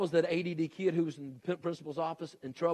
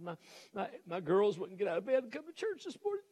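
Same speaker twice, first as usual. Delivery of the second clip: hammed-up tearful whine, rising higher; mock crying; 4 semitones higher than usual. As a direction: voice climbing into a high whimper. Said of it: "she was sitting there crying".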